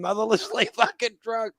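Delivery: high, laughing through the words; cracking up while he tells it. Real, not acted. Storyteller cracking up at his own free-drinks trick, laughing.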